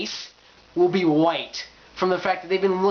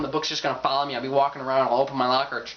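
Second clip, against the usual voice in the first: ordinary delivery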